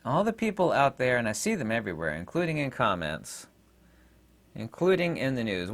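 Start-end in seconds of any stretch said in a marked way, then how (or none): none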